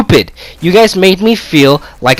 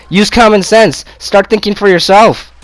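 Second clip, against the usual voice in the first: normal talking voice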